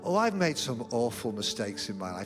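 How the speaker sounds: usual speaking voice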